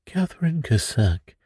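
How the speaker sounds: plain reading voice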